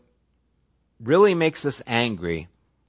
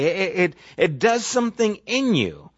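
ordinary talk, nothing marked

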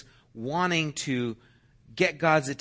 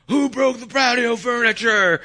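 loud, high stern stepfather voice; angry stepfather; 10 semitones higher, 8 dB louder than usual. Retold or imitated. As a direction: demand to know who did it, loud and high. Stepfather confronting the kids.

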